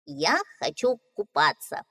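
cheerful little duckling. Acted. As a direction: voice the young duckling brightly. Duckling speaks.

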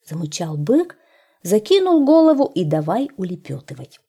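usual speaking voice

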